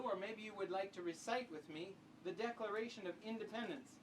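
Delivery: usual speaking voice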